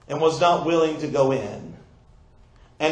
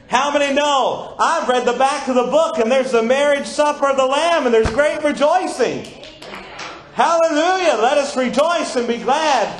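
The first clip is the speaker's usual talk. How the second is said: rousing the congregation toward Hallelujah; rising preaching fervor; 6 semitones higher, 5 dB louder than usual. Acted. Preacher rallying the church, building to a joyful shout.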